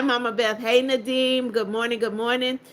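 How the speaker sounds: ordinary talk, nothing marked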